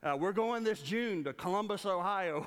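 usual speaking voice

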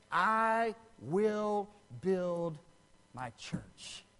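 word by word, very slow, dropping to a hush; slow, spaced words, MY CHURCH low and soft; solemn quoted promise. Retold or imitated.

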